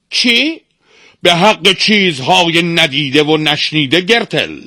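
high, drawn-out disbelief; astonished. A narrator's reading. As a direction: stretch the words in high-pitched amazement. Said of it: "از تعجب گرد شده بود".

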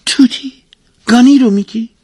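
very high, loud disbelief; incredulous squeal of a question; astonished. A narrator's reading. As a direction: ask in shrieky high amazement. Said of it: "زن با تعجب پرسید".

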